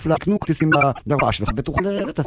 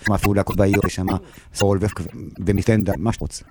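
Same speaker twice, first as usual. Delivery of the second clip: usual speaking voice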